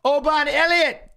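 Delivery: high, drawn-out announcer call; fighter announcement. Real, not acted. Host introducing a fighter's record, announcer call.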